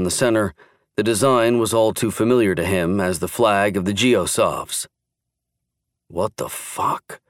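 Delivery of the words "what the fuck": slow, whispered disbelief; muttering in disbelief. A narrator's reading. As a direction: breathe it out, stunned. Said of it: "he mused".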